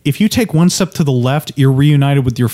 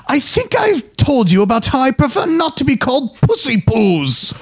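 fussy and offended, pitched up; John Cleese impression; 10 semitones higher than usual. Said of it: "John Cleese going".

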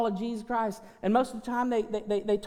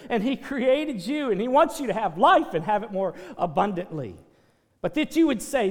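loud, driving pulpit preaching; booming revival preacher voice; full-force preaching; 8 dB louder than usual. Acted. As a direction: hammer it home to the congregation.

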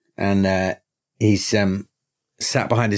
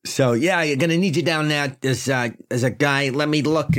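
higher put-on chief voice; police chief voice; 6 semitones higher than usual. GM back in chief voice.

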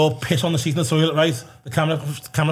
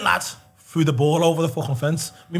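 normal talking voice

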